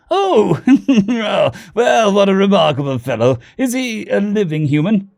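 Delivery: high, chortling upper-class British voice; posh British accent. A narrator's reading. Diplomat admires the wealthy human, posh British accent. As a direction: marvel like a posh British gentleman. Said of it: "The posh upper-class British accent".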